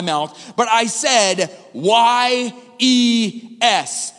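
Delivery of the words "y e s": each letter "Y E S" drawn out slowly one by one; spelled out slowly. Shown as a demonstration. Pastor spells his fateful answer to the congregation, slowly. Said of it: "I'm gonna spell for you".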